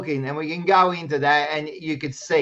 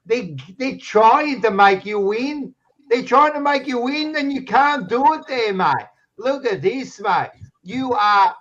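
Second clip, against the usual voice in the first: mocking Australian sing-song, rising much higher; taunting; 8 semitones higher than usual. Real, not acted. Ribbing his mate over the free kick ladder, teasing sing-song. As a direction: rub it in, teasing pitch climbing up.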